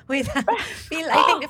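laughing high; laughing as she speaks. Real, not acted. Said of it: "feel na feel ko yung giggle mo".

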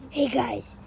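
usual speaking voice

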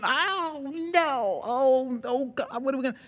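high, frantic cries of alarm; panicked angels. Retold or imitated. Preacher voicing angels at the garden, high frantic alarm. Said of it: "the angels are going".

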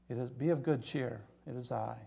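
soft, hushed comfort; quiet reassurance. Acted. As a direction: say it gently, well below speaking volume. Repeats Jesus's words, now gently.

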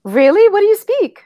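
high, loud, eager question; surprised. Real, not acted. Teacher hears of student's unusual languages, surprised. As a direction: ask in real surprise, pitch jumping up. Reacts to learner's odd languages.

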